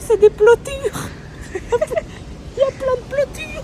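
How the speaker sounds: high, slow, laughing delight. Real, not acted. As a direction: laugh through the silly word. Laughing at the odd word.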